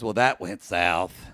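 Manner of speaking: a hint of a low, slow inner doubter; lightly voiced old trauma. Old trauma in the back of the head speaking, lightly voiced doubter. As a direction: draw it out low, like trauma piping up. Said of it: "old Trauma in back head goes".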